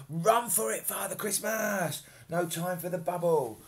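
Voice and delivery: loud high urgent cry to run; urgent shout. Acted. Fairy urges Santa to flee, loud urgent shout. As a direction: call out in a panic. Fleeing the barking puppy.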